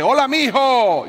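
put-on loud, high shout of a hard-of-hearing old man; voz alta de viejito. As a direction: call it out across the bus. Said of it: "en tono altisonante dice". (grito fuerte de anciano sordo)

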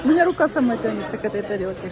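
ordinary delivery

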